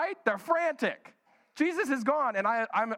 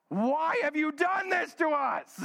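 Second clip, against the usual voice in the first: higher, slow, drawn-out scolding shout; mock mom scolding; 8 semitones higher, slower than usual. Preacher imitating a mom demanding why you did this, slow and shouted. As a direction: play the exasperated mom yelling at her kid. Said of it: "that question from a parent".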